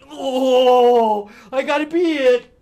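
put-on high, loud whining kid; whiny Fat Boy. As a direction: whine like a sulky boy who must be it. Fat Boy complains as seeker.

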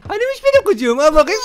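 high cooing with a laugh; playful baby-talk coo. Pretend.

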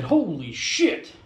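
slow, drawn-out amazement; retold awe at a bargain, slow; slow amazed. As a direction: savour the deal slowly, impressed. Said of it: "I said".

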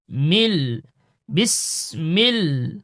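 ordinary delivery

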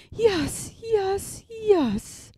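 put-on high, loud old sow voice; a flustered pig nodding along; fussing yes. Aunt Pettytoes agreeing eagerly, high and loud.

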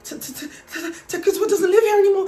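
high, stammering frightened voice; imitated hyperventilating. Frightened woman answering at the door, imitated hyperventilating. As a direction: stutter the name, then rush it out in panic. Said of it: "hyperventilating. She's shaking at the door".

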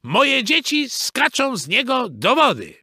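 high-pitched motherly protest; indignant call. A narrator's reading. Mrs Beaver defending her children's tree, calling out. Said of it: "zawołała pani Bobrowa".

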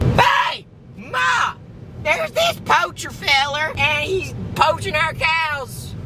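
put-on shrieking high southern farm kid; southern accent. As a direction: holler for Ma in a slow southern twang. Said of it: "everybody has a southern accent".

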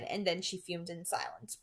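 ordinary delivery

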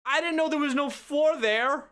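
high, flustered after a fall; gasp, then blurt it out high; shocked high gasp. Real, not acted. Knocked off a ledge in-game.

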